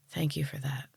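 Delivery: soft, hushed thanks; quiet. A narrator's reading. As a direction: let it fade to almost nothing. Harper's soft grateful farewell.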